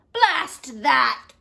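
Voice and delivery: loud indignant blast; bellowed. Acted. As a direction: bellow it in outrage. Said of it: "bellowed Taft".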